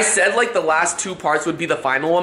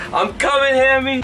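high, eager call through the house; excited call; 8 semitones higher than usual. Real, not acted. Vlogger calling out to his hamster Hammy, high and excited.

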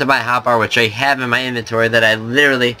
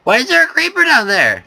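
much higher, alarmed squeal of surprise; shocked; 13 semitones higher than usual. Real, not acted. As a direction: shriek in disbelief at the creeper. Shocked at creeper.

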